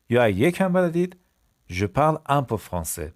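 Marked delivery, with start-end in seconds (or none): 1.7-3.1 je parle un peu français said slowly; slow model. Shown as a demonstration. Teacher to class, slow clear model. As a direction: lay out each word for learners. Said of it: "slow model sentence".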